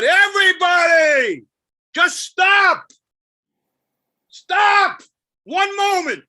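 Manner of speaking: high, loud, drawn-out cry to stop; desperate shout. Acted. Poet pleading with everybody to stop, shouting. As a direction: yell it out over the whole crowd.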